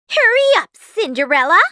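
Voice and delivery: high, loud screeching order; bossy stepsister yell; impatient shout. A narrator's reading. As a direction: yell to hurry her along. Stepsisters hurry Cinderella.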